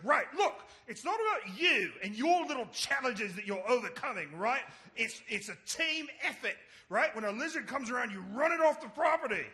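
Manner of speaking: high, loud lecturing rant; indignant scolding. Retold or imitated. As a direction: imitate a coworker laying down the rules. [name]'s acted scolding rant.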